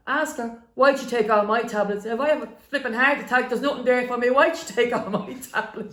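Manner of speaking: loud, high-pitched aggrieved complaint; indignant dad. Retold or imitated. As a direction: imitate an exasperated dad, loudly protesting. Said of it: "here's my dad".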